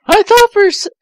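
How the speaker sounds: ordinary delivery, nothing marked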